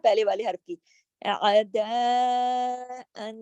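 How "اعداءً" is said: اعداءً drawn out very slowly; long madd recitation. Shown as a demonstration. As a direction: stretch the madd fully. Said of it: "دال کے اوپر ... مد بھی ہے". (اعداءً مد کے ساتھ لمبا)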